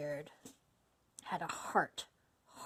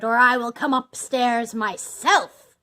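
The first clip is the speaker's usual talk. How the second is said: loud, slow, forceful warning; enraged villain threatening; shouted threat; 3 semitones higher, 11 dB louder, slower than usual. A narrator's reading. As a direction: bellow it with drawn-out menace. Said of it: "shouted Bluebeard".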